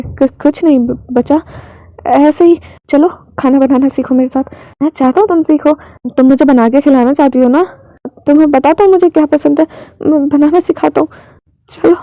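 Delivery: usual speaking voice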